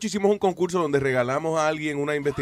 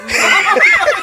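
loud laugh; 10 dB louder than usual. Real, not acted. Laughs at friend denying pain.

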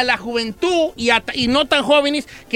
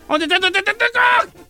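high, rapid garbled crowd call; mock a colleague's unintelligible shout-out; hammed-up jumbled host yell; imitating el chino; 10 semitones higher than usual. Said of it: "El chino dice, el chino habla".

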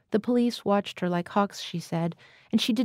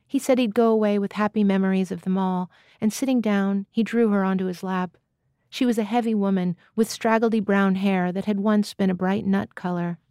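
plain reading voice

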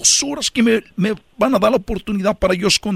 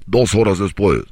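deep narrator voice; 12 semitones lower than usual. Acted. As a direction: announce the time jump gravely. Narrator time skip card. (voz grave de narrador)

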